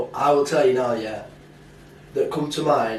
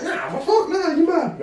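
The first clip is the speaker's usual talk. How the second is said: much higher, squeaky mock outrage; mock indignant denial. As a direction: play the flustered kid denying it, pitched way up. Retold denial to school friend.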